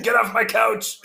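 high, mock-angry shout; grumpy old man. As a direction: yell like a cranky old guy. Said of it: "Half Sack as an old grumpy".

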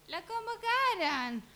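high, slow, squealing yell from the balcony; excited shout. Retold or imitated. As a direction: shriek the news with joy. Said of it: "casa y grita". (Wanda gritando desde el balcón, chillido de emoción)

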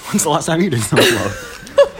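loud, high, slowed by laughter; teasing question squeezed through laughter; laughing tease. Real, not acted. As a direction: ask it while cracking up.

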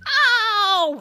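high, drawn-out acted "ow"; cry of pain. Henry blasted in the eye, long mock pain cry. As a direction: yelp as if hit, sliding down. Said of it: "he got blasted in the eye".

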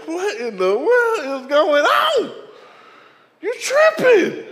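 slow, high, loud disbelief; incredulous. Retold or imitated. Pastor reacting to his wife, slow high disbelief. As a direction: drag it out in high shock. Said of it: "And I was like".

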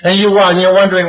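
ordinary talk, nothing marked

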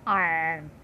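a hint of a stock pirate. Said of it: "stereotype pirate would say it".

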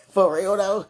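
loud, playful nineties catchphrase; big teasing slang delivery; Brat catchphrase. Pretend. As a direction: throw it out loud, mock-tough and joking. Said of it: "Hit the brat on him".

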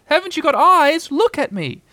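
exasperated, high Piglet cry; shouted. A narrator's reading. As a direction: demand attention in a squealing shout. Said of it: "shouted Piglet".

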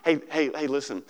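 ordinary talk

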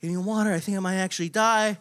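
mildly acted desperate plea; begging. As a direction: plead for help, lightly re-enacted. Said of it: "begging, like, for, like, water".

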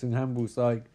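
ordinary talk

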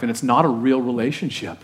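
usual speaking voice

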